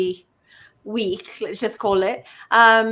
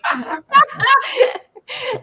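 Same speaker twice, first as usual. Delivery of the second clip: a burst of flustered giggling, high-pitched; embarrassed high laugh; 8 dB louder than usual. Real, not acted. Host laughing at herself for stumbling over words.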